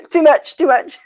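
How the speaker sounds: high, loud flustered protest; mock overwhelm. Listener protesting to a too-fast talker, comically overwhelmed. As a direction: cry enough in comic overload. Acts out being overloaded by fast talk.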